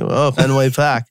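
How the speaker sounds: teasing imitation of Boston fans; mocking Boston accent. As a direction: drop the R in "Park" like a mocked Bostonian. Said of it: "making fun of Boston fans".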